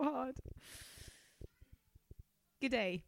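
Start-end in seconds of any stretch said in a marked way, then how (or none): 2.6-3.0 hammed-up Australian greeting; horrendous Australian. Carly introducing herself, in a horrendous Australian accent. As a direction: put on a bad Aussie accent. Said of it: "Horrendous Australian".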